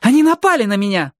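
high, indignant cry; exclaiming. A narrator's reading. Али accuses her attackers, exclaiming indignantly. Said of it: "воскликнула Али".

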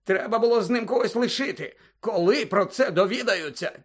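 high, stammering worried official; flustered official. Acted. Fudge flustered, arguing. (розгублений чиновник, високим голосом)

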